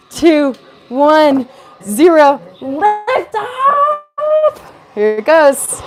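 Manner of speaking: loud, high countdown and cheer; excited cheering. Real, not acted. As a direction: shout it out as the rocket lifts. Counting down to rocket liftoff.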